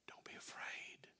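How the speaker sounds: slow hushed whisper of comfort; a soft whisper to a frightened child; whispered reassurance. Shown as a demonstration. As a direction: breathe it out gently, slowly. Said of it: "what he says to his children".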